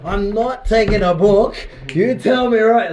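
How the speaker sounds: shouting him down; aggressive shout. Retold or imitated. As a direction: corner him and demand an answer. Retold aggressive demand to distributor.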